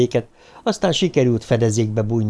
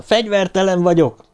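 shouting; 8 semitones higher than usual. Acted. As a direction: call out that you're unarmed. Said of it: "kiáltotta".

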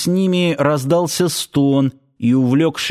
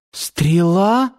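surprised question; 6 semitones higher than usual. Acted. Girl surprised at arrow.

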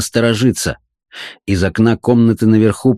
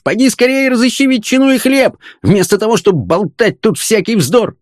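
high-pitched, exasperated ranting; furious tirade; 11 semitones higher than usual. A narrator's reading. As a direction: berate the girl at the end of all patience. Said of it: "окончательно рассвирепев".

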